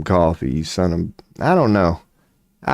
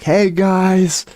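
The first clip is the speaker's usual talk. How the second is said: higher, called out from across the room; mock call from the corner, pitched up; yelling back; 11 semitones higher, slower than usual. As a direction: shout it back to the others from a corner. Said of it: "yelling back".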